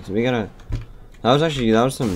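usual speaking voice

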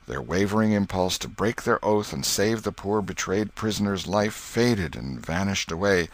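ordinary delivery

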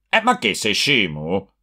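plain reading voice

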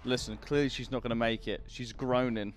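low, soft menacing shopkeeper; shady low character voice. Acted. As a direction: lean in low and threatening.

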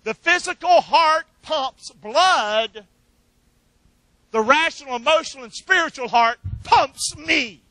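slow, loud preaching cadence; preacher hammering home his point, loud and slow; preaching at full force. Acted. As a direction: preach it out, building to a shout.

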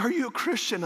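high-pitched imitation of a tearful man; mock crying. Imitating a crying passenger asking the preacher, mock tearful. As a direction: plead it high, as a hammed-up crier. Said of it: "he's sitting there crying".

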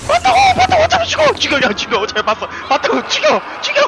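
high desperate screaming; being chased. Real, not acted. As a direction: scream it out, frantic and shrill.

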